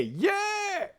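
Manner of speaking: very high, drawn-out "yeah"; high-pitched celebratory whoop; excited cheer. Real, not acted. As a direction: cheer your team's win. Cheering own team's win.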